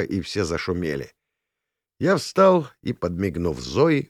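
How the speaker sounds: ordinary delivery, nothing marked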